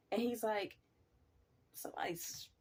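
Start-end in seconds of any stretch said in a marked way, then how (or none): 1.7-2.5 whisper. Retold or imitated. Stage manager voiced whispering.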